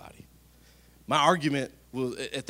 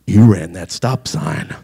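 put-on much louder, slow childish accusing call; little daughter; 4 semitones lower, 15 dB louder, slower than usual. Six-year-old Piper scolding her dad from the back seat, loud childish accusation. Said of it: "She said".

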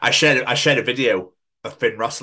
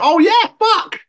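sudden recall; 10 dB louder, slower than usual. Real, not acted. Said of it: "remembering the gig".

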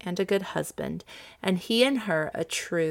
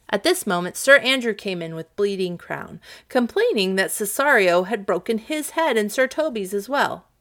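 plain reading voice

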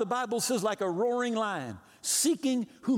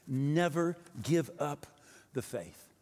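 ordinary talk

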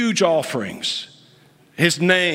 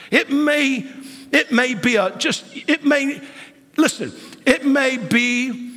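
high, pressing preacher's voice; fervent build; 9 semitones higher than usual. Real, not acted. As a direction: lift it high with fervor. Preacher climbs to high pitch.

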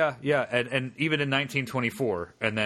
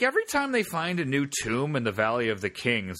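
ordinary delivery

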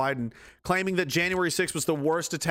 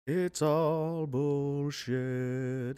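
very slow, softer, dragged out; bitter drawn-out aside; 4 semitones lower, 5 dB quieter, much slower than usual. Acted. After mocking the anthem, he drags out a soft bitter verdict. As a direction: let it sag out slowly in disgust.

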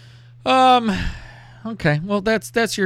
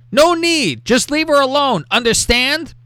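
high, indignant mock ultimatum; mock angry warning; 11 semitones higher, 4 dB louder than usual. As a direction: warn off a rival in exaggerated outrage. Reads boyfriend warning off rival.

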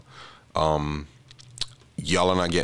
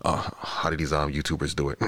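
ordinary delivery

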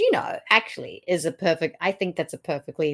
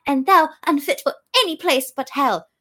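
higher, louder theatrical rebuke; acted scorn; 8 semitones higher, 5 dB louder than usual. Lady Anne damning Richard, acted scorn. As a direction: hurl it back as Lady Anne.

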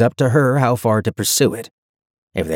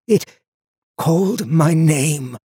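slow, high, haunted words; halt, then let it out in horror; drawn-out fearful stage whisper; shuddering dread; 7 semitones higher, slower than usual. A narrator's reading. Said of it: "Lucas shuddered".

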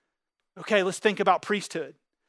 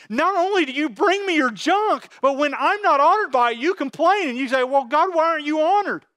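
high, loud whiny mock complaint; mocking complainer; 6 semitones higher, 4 dB louder than usual. God mimicking complaining people, high loud mock whine. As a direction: whine at God like a spoiled worshipper. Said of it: "And you snort at it".

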